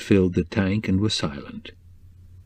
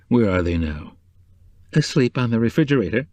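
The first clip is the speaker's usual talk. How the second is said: higher, anxious motherly voice pouring out relief; anxious older woman, relieved at last; worried housekeeper; 6 semitones higher than usual. A narrator's reading. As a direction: she was up all night fretting, let it tumble out. Mrs Cobb, worried, relieved.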